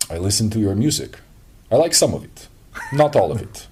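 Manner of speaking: hammy Russian tour manager; Russian accent. Retold or imitated. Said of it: "I'm gonna do a very terrible Russian accent".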